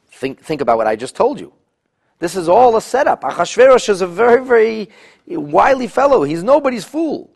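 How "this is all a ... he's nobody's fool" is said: much higher and louder, pressing the point; emphatic warning. Real, not acted. Teacher to class, loud emphatic insistence. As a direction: insist on it, wide-eyed. Teacher stressing the king's cunning.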